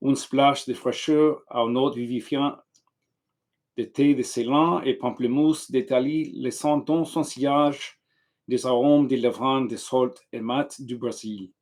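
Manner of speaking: French read aloud with an English speaker's accent. Shown as a demonstration. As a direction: read the French text carefully, slightly anglicized. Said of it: "so I'm gonna try to read it in French".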